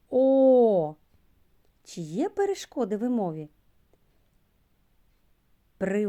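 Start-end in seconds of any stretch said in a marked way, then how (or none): 0.1-0.9 loud, long ringing O; sound demo. Shown as a demonstration. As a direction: call out the О for the class to copy. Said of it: "Давайте разом вимовимо".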